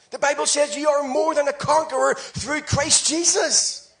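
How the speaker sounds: usual speaking voice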